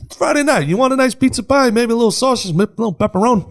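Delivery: sing-song Friday night pizza pitch; relaxed New Yorker. Retold or imitated. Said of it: "But if I'm".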